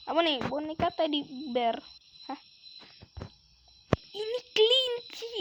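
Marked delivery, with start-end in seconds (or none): 4.1-5.3 "Ini kelinci" said much higher and slowly, stretching "kelinci"; squeaky drawn-out "kelinci". Shown as a demonstration. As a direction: sing "Ini kelinci" up high like a cute reveal. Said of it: "Ini kelinci udah pasti tuh".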